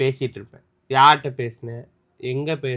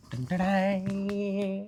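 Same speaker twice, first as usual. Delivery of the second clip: drawn-out soft higher ta-da; mock fanfare; 6 semitones higher, 7 dB quieter, much slower than usual. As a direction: sing out a slow soft ta-da. Announcing reveal.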